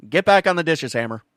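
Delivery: calling Hammer back to the dishes, loud; yelling upstairs. Real, not acted. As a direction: shout it at him in the next room.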